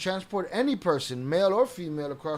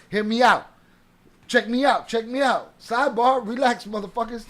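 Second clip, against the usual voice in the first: louder, higher, demanding attention; calling out; 5 semitones higher, 7 dB louder than usual. Real, not acted. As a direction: raise your voice, hear me out.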